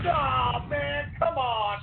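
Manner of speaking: normal talking voice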